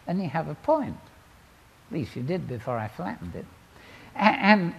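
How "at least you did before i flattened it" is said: normal talking voice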